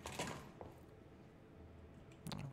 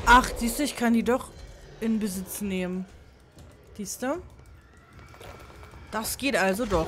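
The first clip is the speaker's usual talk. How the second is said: excited; 3 semitones higher, 6 dB louder than usual. Real, not acted. Gamer discovers he can claim it.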